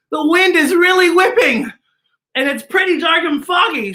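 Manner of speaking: "whipping" and "pretty" said loud and high, hammed up; dramatic loud reading. Shown as a demonstration. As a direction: read it big, like a storm is blowing. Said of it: "So wait a minute".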